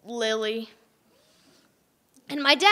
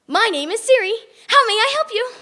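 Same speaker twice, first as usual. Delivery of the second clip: higher, louder assistant voice; Siri voice; 7 semitones higher, 5 dB louder than usual. Acted. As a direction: speak like a phone assistant. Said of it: "turned Emily into Siri".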